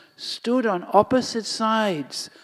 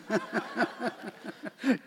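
soft laugh; 3 semitones higher, 9 dB quieter than usual. Acted. Mother amused by child's question.

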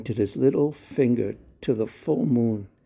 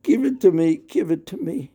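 put-on loud, high child's demand; childish cry for the moon; crying out loudly; 7 semitones higher, 5 dB louder than usual. A narrator's reading. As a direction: shout it like a wailing child. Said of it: "he cried out loudly".

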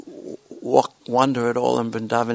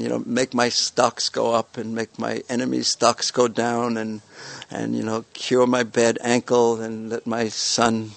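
normal talking voice

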